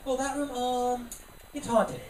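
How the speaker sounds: slow, flustered mom making up an answer; stall, then blurt the excuse; panicking mom. Retold or imitated. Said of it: "She panics, just goes".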